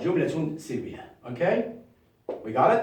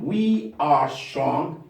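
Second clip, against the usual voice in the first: we are strong, very slow; slow model read; slow dictation; much slower than usual. Shown as a demonstration. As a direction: drag each word for the class. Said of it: "modelling sentence".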